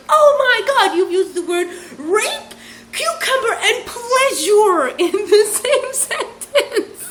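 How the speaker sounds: high, drawn-out shriek through laughter; very loud. Retold or imitated. Reacting to the cucumber remark, very loud laughing outrage. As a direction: shriek each word in hammed-up horror. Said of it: "Very loudly".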